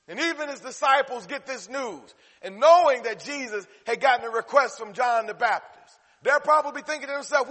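high, loud, urgent sermon narration; forceful preaching. Real, not acted. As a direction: drive the story on from the pulpit.

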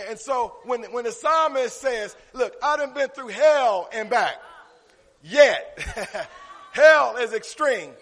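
loud, rising preacher's delivery; full-force preaching. Real, not acted. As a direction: preach it hard to the congregation.

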